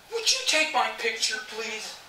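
high, loud, eager polite ask; photo request. Retold or imitated. Asks someone for a photo.

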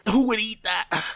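high, revolted exclaiming; disgusted. Real, not acted. Player telling viewers the food is awful, disgusted. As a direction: recoil from a horrible taste. Disgusted by what he just ate.

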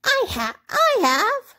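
very high little-rabbit protest; high-pitched insistent toy rabbit; crying out. A narrator's reading. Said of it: "cried the little rabbit".

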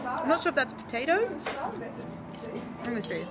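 chewing while talking; eating between words; mouth full. Real, not acted. Seen 0: Bites at -3 and +5.0; chews and talks between bites.

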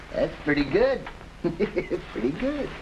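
high, laughing "pretty good"; villain chuckling his approval of the henchman's joke. Acted. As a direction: let the laugh spill into the words. Flat Top still laughing at joke.

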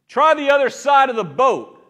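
loud, high call with a friendly tip. Acted. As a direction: shout the advice across the water. Jesus calling to the boat.

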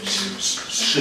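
drawn-out, slightly whistled "Ш-, ш-, ши"; whistling Ш. Shown as a demonstration. Coach points out a reader's fault, "Ш-, ш-, ши" with whistled hiss. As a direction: isolate and stretch Ш in "Ш-, ш-, ши". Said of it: "підсвищує ши".

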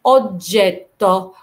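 very slow "اوجيتو", both g's as j; doubled soft g. Shown as a demonstration. Teacher applying the rule slowly, doubled soft g. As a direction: break "اوجيتو" at the held double j. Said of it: "تتبع التانية في النطق".

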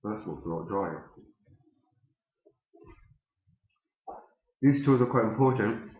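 talking around pipe stem; pipe in mouth. Real, not acted. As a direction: teeth clenched on pipe. Seen 1: Pipe stem clenched in teeth throughout the labelled words.

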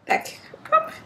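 ordinary delivery

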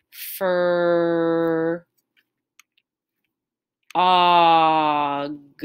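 very slow, split into "fur" and "og"; slow and smooth. Shown as a demonstration. Teacher splits "frog" into parts for the class. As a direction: drag out each part of the word. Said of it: "how I said this word slow and smooth".

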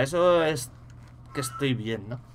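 ordinary talk, nothing marked

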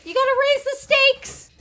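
high-pitched talking-dog voice; voiced bargaining dog. Dog demanding better treats, high put-on voice.

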